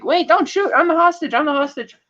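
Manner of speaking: high, frantic pleading not to be shot; a terrified woman begging the agents; panicked plea. Retold or imitated. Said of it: "she drops the gun and is like".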